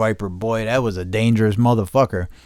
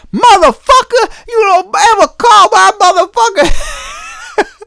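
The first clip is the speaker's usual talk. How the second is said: much higher and louder, slow shrieking mock rage; imitating screaming woman; 11 dB louder, slower than usual. Mocking the angry caller's screamed threat, shrieking high. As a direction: shriek it like the furious lady on the phone. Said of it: "She said".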